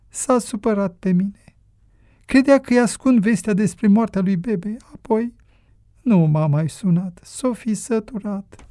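high-pitched mother telling her troubles; plaintive, high motherly voice; mother confiding, plaintive. Acted. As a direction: confide in a dear son in a high voice.

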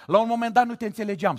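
fast rushed repeat. Shown as a demonstration. Preacher teasing a sister behind him, fast. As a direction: rattle it off fast. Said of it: "repeated take".

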